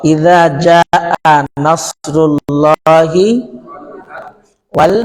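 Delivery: slow tajweed recitation; Quran recitation. Shown as a demonstration. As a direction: chant it slowly, drawing out each vowel. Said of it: "recites Quran for class".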